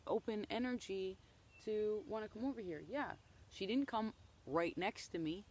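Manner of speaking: ordinary talk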